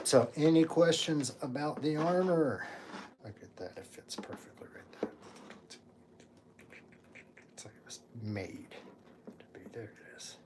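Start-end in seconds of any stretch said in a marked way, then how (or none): 3.2-10.4 whisper. Real, not acted. Admiring fit under breath.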